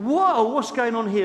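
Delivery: sudden high, loud 'whoa' of surprise; shocked. Retold or imitated. Guest seeing bride strip to swimsuit, loud mock shock. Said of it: "I thought".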